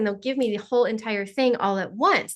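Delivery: ordinary talk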